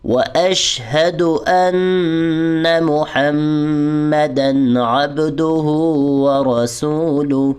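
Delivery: very slow, drawn-out chant; slow recitation. Shown as a demonstration. Teacher reciting the next phrase. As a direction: stretch every word. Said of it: "آگے دیکھیے".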